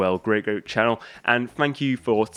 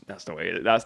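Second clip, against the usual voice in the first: ordinary talk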